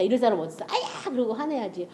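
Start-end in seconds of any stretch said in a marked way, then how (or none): 0.7-1.1 mock ouch. Stepped on, cry of pain.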